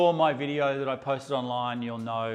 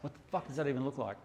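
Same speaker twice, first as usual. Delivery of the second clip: muttered aside; 9 dB quieter, faster than usual. Real, not acted. Quick scornful aside.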